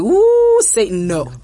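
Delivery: high, loud whoop, then a flat refusal; mock recoil. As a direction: shriek "Woo" as if warding off Satan, then say no. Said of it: "And she's like".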